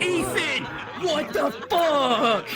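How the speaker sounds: high, slow, stretched-out what the fuck; appalled outburst, way up high; shocked yell. Retold or imitated. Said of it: "And I'm like".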